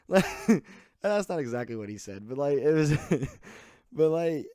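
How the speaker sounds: loud, high, talking through laughter; cracking up mid-sentence; real laugh breaking into the words. Real, not acted. Cracking up after the impression.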